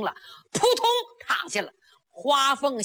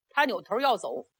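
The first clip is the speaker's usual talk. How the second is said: usual speaking voice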